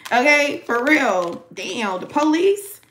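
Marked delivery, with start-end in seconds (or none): none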